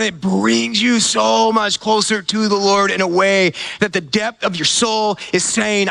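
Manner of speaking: usual speaking voice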